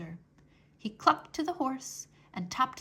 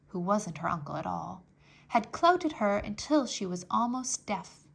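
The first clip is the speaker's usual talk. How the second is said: plain reading voice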